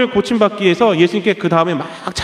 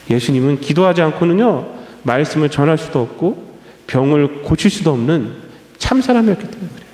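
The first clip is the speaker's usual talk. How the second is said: ordinary delivery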